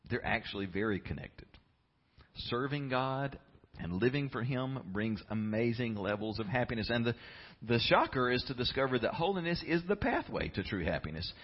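ordinary talk

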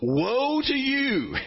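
slow, high, drawn-out woe; Jesus pronouncing woe. Retold or imitated. Preacher voicing Jesus's warning to leaders, slow high woe. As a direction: stretch the woe out like a prophet's warning. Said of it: "he begins every new section by going".

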